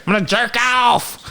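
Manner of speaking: high-pitched shouted outburst with a whoop; mock gleeful shout. Retelling a friend's announcement, mock gleeful shout. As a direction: imitate him crowing it, way up high.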